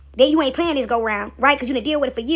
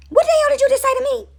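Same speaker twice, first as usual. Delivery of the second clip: high-pitched, loud indignant demand; pitched way up in disbelief; mock outrage; 6 dB louder than usual.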